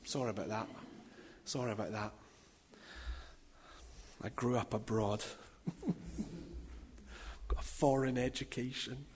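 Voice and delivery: low, very quiet throwaway apology; sheepish aside. Real, not acted. Preacher joking to congregation about a mispronunciation, sheepish low aside.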